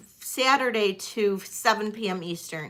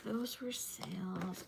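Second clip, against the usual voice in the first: whispering; 3 semitones lower, 17 dB quieter, slower than usual. Real, not acted. Checking notes to herself.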